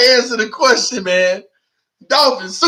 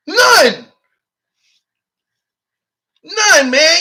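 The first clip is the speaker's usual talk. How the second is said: emphatic shout; 6 semitones higher, 4 dB louder, much slower than usual. Real, not acted. Answering his own rhetorical challenge.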